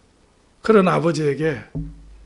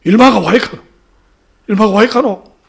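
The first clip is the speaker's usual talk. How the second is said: loud, startled dialect muttering; flustered father; 3 semitones higher, 12 dB louder than usual. Retold or imitated. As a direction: blurt it twice, completely at a loss. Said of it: "아버지가 어쩔 줄을 몰라요". (어쩔 줄 몰라 당황한 사투리)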